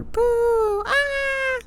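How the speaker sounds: very high-pitched boo and squeal; squeaky pretend scare scream; mock jump scare. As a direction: shriek it thinly like a fake ghost fright.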